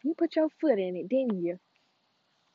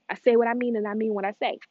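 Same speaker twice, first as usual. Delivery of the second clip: ordinary talk, nothing marked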